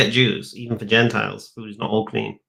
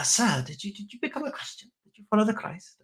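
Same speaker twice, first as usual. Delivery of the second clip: much higher, softer mock-sly questioning; 8 semitones higher, 9 dB quieter than usual. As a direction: creep up on him with a high, hushed question.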